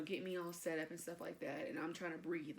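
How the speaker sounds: ordinary talk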